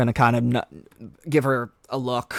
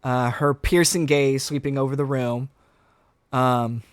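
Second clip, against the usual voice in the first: ordinary talk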